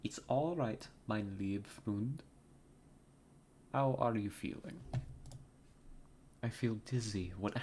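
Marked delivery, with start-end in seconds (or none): 0.0-4.8 put-on light German accent, slow on mein lieb Freund; a gentle German friend reassuring, lightly accented. German friend soothes the dazed detective, light German accent. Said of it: "I need to try to do the accent again".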